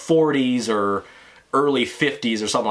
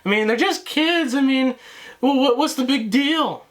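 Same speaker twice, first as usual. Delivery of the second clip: high, squeaky mock of a laughing woman; 11 semitones higher than usual. The laughing neighbour shrugging off the kid, high mocking voice. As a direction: ham up her giggling dismissal. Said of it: "like, laughing about it".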